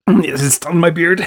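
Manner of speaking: slow, laughing through a mouthful; mouth full. Real, not acted. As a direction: chew and laugh the words out. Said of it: "stuff your face full of spaghetti".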